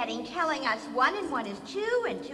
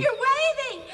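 laughing; 10 semitones higher, 6 dB louder than usual. Acted. Laugh heard while seeing him.

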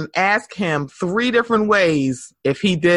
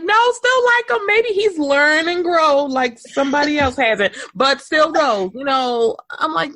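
conflicted fan; 8 semitones higher than usual. Retold or imitated.

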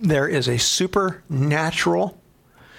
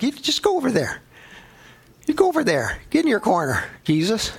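higher, mock-stubborn brush-off; mock pushback; 7 semitones higher than usual. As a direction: shoo him away like a stubborn kid. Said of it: "I'm like".

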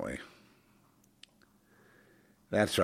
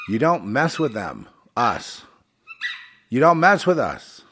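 threatening warning; 6 semitones higher, 7 dB louder than usual. Retold or imitated. Warning quoted from the attackers.

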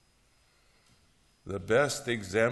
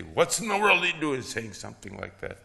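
mild mock anger; 4 semitones higher, 5 dB louder, faster than usual. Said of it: "they're mad".

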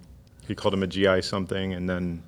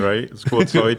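louder mock Canadian, "oot-side"; playfully hammed-up Canadian accent; 3 semitones higher, 6 dB louder than usual. Shown as a demonstration. Co-host joining the joke, hammed-up Canadian accent. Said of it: "go all Canadian".

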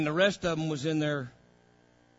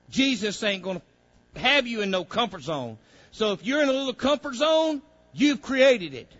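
loud, higher, driving preacher's call; full-force preaching; 8 semitones higher, 9 dB louder than usual. Acted. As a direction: preach it hard to the congregation.

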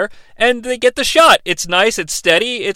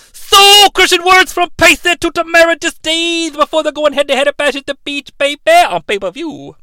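loud, higher wrestling-promo voice, shouting; Dusty Rhodes impression; 8 semitones higher, 3 dB louder than usual. Co-host as Dusty Rhodes cutting a promo on the recap, loud and high. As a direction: hype it like an old wrestling legend cutting a promo. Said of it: "[name]'s Dusty Rhodes".

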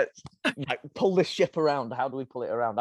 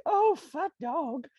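much higher, slow sing-song to the dog; mock cooing; 13 semitones higher, much slower than usual. Parent cooing at the greedy puppy, high slow sing-song. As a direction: coo it slowly, teasing the puppy.

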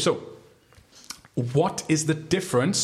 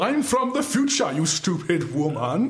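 much higher, slow hammed-up exclaim; buzzer quote voice; 5 semitones higher, slower than usual. Host reading out the buzzer quote options in a silly voice. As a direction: ham up the time traveller line. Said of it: "what was the first two again".

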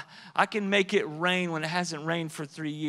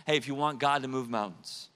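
usual speaking voice

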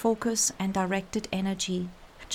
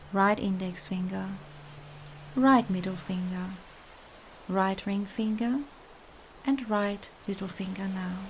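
calm meditative tone. Acted. Guided relaxation meditation.

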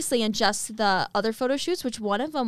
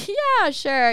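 offhand agreement; 9 semitones higher, 7 dB louder than usual. Retold or imitated. Voices roommate's doubtful reply.

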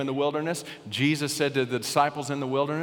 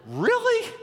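high squeaky disbelief, much higher; incredulous prayer; 10 semitones higher than usual. Retold or imitated. Pastor retelling his stunned prayer after the vote, squeaky disbelief. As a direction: play your own stunned self questioning God. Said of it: "this was my prayer".

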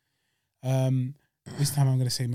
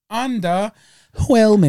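"Underwhelming" stretched slowly, louder and higher; drawn-out emphasis; 5 semitones higher, 6 dB louder, much slower than usual. Shown as a demonstration. He repeats the verdict on the rivalry, slow and louder. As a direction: draw out "Underwhelming" with scorn. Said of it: "repeats word, slow and louder".

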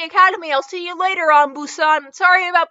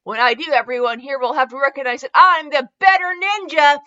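raised, emphatic boast; determined challenge; 2 semitones higher, 3 dB louder than usual. Acted. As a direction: declare victory before the fight. Acted challenge before sparring match.

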